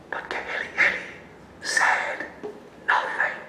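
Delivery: hushed storyteller's whisper. A narrator's reading. Storyteller hushes the crowd, whispering.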